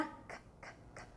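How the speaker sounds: whispered K, k, k; soft repeated k with no voice; k sound. Shown as a demonstration. Teacher sounding out cachorro for pupils, voiceless k. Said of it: "você já fez o K".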